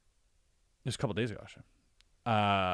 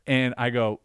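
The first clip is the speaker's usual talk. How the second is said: ordinary delivery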